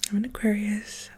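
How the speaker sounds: normal talking voice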